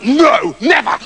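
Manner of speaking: high, dramatic protest; horrified refusal. Acted. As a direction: refuse in hammed-up horror. Septimus refuses the turnip.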